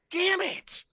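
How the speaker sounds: high, loud mock curse; comic yelp of defeat; exasperated outburst. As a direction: curse in high-pitched frustration. [name] curses after failed joke.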